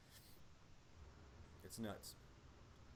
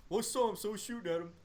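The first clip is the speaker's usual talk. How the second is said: fast, higher mock excuse; rushed excuse; 8 semitones higher, 5 dB louder, much faster than usual. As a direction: soldier blurts his excuse fast and higher. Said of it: "And he's like".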